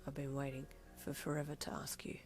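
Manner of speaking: low, very hushed, tender; soft smile. A narrator's reading. Kuro answering his new fiancee, softly smiling. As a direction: murmur it lovingly, low and quiet. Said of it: "he replied with a soft smile".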